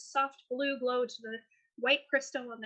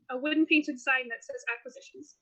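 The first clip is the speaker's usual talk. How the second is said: ordinary talk, nothing marked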